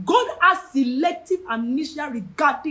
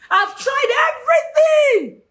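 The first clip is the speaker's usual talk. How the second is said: high, loud, drawn-out wail; acted cry of utter frustration; desperate shout; 10 semitones higher, 5 dB louder than usual. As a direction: shout it slowly, stretching the last word. Acts out a desperate believer.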